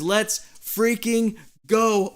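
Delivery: slow, high, stretched-out cheer; excited cheer. Real, not acted. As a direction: drag the cheer out. Reading fan's cheer out loud.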